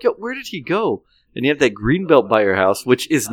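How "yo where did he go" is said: high bewildered question; puzzled call. Retold or imitated. Friends searching the dark yard, high bewildered. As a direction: ask where your buddy vanished to. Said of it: "we're like".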